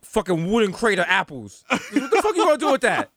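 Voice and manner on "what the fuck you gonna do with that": laughing mock despair. Mock despair over apples.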